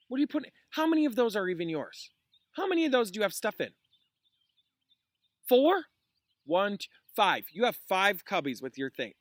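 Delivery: teacher stops reading to scold a student, exasperated; real scolding. Real, not acted. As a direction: call the student out in disbelief.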